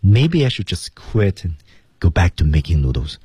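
ordinary talk

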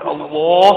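الله with a thick, full-mouthed ل; heavy lam. Shown as a demonstration. Teacher showing tafkhim, heavy ل in الله. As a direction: thicken and hold the doubled ل in الله. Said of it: "ونفخم اللام".

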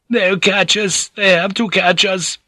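high, slow tearful wail; high-pitched tearful villager; weeping. A narrator's reading. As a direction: wail in fearful despair. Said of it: "Ninjin had wept".

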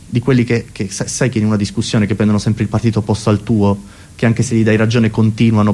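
ordinary talk, nothing marked

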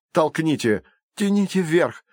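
high-pitched, effortful commands; urgent instructions. A narrator's reading. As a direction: urge them to heave together. Urging others to heave body up. (высокие, напряжённые команды)

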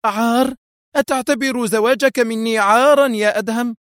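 high-pitched, stricken question; alarmed. A narrator's reading. Wife asks if their marriage is shame, alarmed. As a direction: echo the word in alarm. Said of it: "رددت في ذعر".

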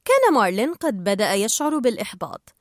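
ordinary delivery, nothing marked

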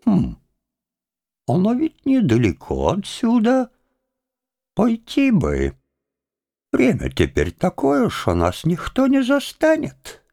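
high, musing villager with swooping pitch; hesitant hopeful plotting to himself; Chub scheming. A narrator's reading. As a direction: think it over slyly, pitch floating up.

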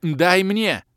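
loud high demand; shout. Acted. Kalen demanding mana mid-spell, shout. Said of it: "крикнула продолжающая магичить Кэлен".

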